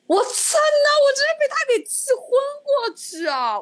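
very high-pitched, worked-up outburst; furious exasperation. Retold or imitated. Telling a friend the painter refused her, furiously exasperated. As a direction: erupt as if about to faint from rage.

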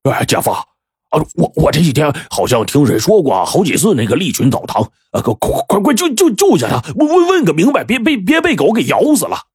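high, halting stutter; stammering simpleton. A narrator's reading. Dim brother-in-law stammers to his boss, panicky and high. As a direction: trip over every word in a panic.

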